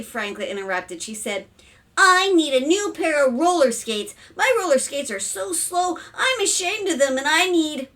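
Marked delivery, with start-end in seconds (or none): 2.0-7.9 put-on high, loud child's demand; whiny daughter. A narrator's reading. Daughter demands new roller skates, whiny child. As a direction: plead like a fed-up kid.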